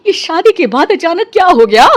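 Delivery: ordinary talk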